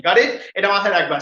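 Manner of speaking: ordinary talk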